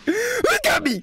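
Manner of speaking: high, slow delighted cry; excited gasp. Real, not acted. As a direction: gasp then cry out in glee. Delighted as the cat.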